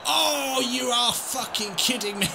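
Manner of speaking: furious shout; disbelieving shout. Real, not acted. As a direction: shout at the missed chance. Missed one-on-one.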